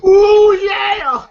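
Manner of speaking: high, loud drawn-out whoop; Ezal's excited holler. Retold or imitated. Narrator imitates a film character calling out, high holler. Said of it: "Yes, he goes".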